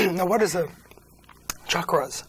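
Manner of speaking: losing voice, strained and rough; hoarse voice. Real, not acted. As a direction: talk through a voice that is going. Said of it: "I'm losing my voice here".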